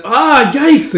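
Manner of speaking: high, loud startled cry; kid's shocked outburst; alarmed yelp. Acted. Child alarmed at injured friend.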